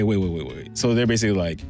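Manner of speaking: normal talking voice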